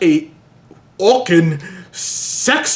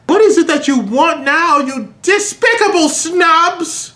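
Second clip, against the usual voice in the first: angry; 9 semitones higher than usual. Acted. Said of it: "He asked angrily".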